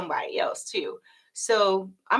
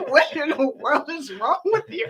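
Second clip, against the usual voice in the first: mock bewildered friend; 9 semitones higher than usual. Friend reacting to her excitement.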